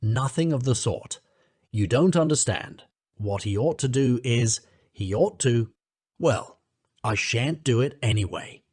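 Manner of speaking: ordinary delivery, nothing marked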